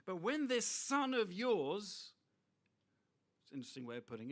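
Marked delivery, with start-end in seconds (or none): 0.1-2.1 louder, slow, rising with indignation; resentful brother raising his voice slowly; indignant older son. Retold or imitated. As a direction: throw out the complaint, slow and aggrieved. Older son's indignant complaint quoted.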